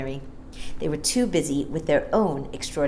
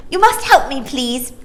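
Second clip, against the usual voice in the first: put-on eager child; 9 semitones higher, 6 dB louder than usual. Maya asks the assistant.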